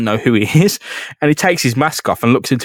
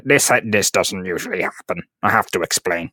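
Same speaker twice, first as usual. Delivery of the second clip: mock villain excuse; 6 semitones lower, 6 dB quieter than usual. Doc Ock voiced, low quiet.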